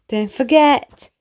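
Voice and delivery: usual speaking voice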